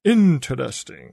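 low, slow vampire drawl; Dracula voice. Shown as a demonstration. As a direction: savour it like Count Dracula. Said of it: "Like Dracula".

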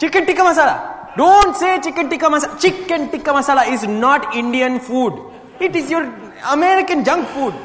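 high, loud indignant outburst; outraged scolding. Acted. Examiner scolding Jay over chicken tikka masala, outraged. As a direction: scold them in comic outrage.

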